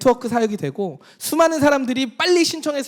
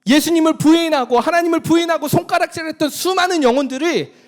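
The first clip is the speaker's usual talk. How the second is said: louder, impassioned sermon; preaching at full force; 5 semitones higher, 6 dB louder than usual. Acted. Pastor urging the congregation, impassioned and loud. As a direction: drive the joy home to the congregation. Preaching climax on resurrection joy.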